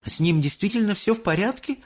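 high-pitched, wondering question; surprised. A narrator's reading. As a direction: ask in surprise, lifting the voice high. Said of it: "удивлённо спросил Чарли". (удивлённо, высоким голосом)

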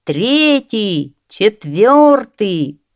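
loud, high, drawn-out count; slow, swelling crowd chant; crowd shout. A narrator's reading. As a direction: call each number out long. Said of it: "кричит толпа".

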